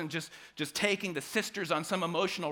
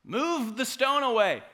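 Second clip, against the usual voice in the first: commanding call; 7 semitones higher, 5 dB louder than usual. Pretend. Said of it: "He said".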